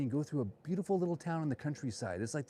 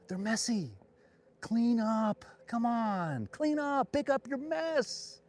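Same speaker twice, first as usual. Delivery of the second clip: fed-up ranting, pitched way up; exasperated demand; 11 semitones higher, much slower than usual. Acted. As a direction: scold them like slobs, pitch climbing, last words drawn out. Exasperated rant demanding a cleanup.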